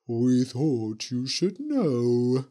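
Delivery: slow, sly ghost witch; lightly voiced spooky witch. A narrator's reading. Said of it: "said the Green Witch".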